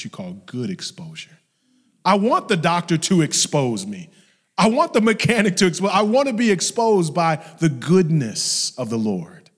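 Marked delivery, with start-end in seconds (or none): 2.0-9.5 high, loud preacher's cry; fervent shouted declaration; impassioned preaching. Real, not acted. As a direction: proclaim it at full force. Preaches at full force.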